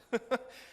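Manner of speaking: a quick real chuckle mid-story; short chuckle. Real, not acted. Preacher to congregation about his coworker, short chuckle.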